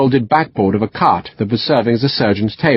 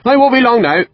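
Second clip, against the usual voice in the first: much higher, breezy reassurance; chirpy surgeon mid-operation; cheerful West Country surgeon reassuring the major; 13 semitones higher, 3 dB louder than usual. A narrator's reading. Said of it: "the surgeon said cheerfully".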